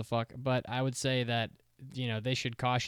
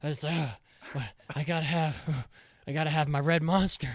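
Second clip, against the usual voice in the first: loud, hammed-up craving; mock shakes; 4 semitones higher, 4 dB louder than usual. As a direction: act out a shaky Monster withdrawal. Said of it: "You're just, like, shaking".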